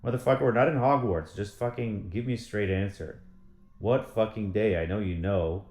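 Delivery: Motherfucker shouted out loud, then it settles into an annoyed rant; retold angry rant. Ranting at a coy industry insider, angry and loud at the start. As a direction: blast the opening word at the coy insider, then keep grumbling. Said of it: "I'm like".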